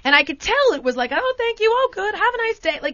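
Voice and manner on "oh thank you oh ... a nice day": bright friendly chatter. Retold or imitated. Mimicking friendly Dutch exchange.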